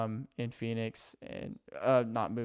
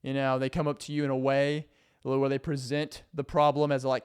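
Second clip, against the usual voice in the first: normal talking voice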